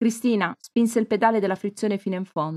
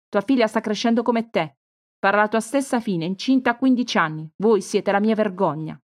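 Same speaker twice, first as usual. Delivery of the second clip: furious scorn; 2 semitones higher, 4 dB louder than usual. Acted. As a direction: pour shame on her daughter. Mother insults daughter furiously. (madre furiosa, voce più alta e forte)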